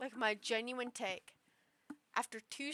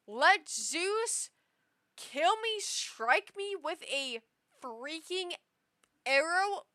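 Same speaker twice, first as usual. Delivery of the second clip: loud mock plea; 5 semitones higher, 8 dB louder, slower than usual.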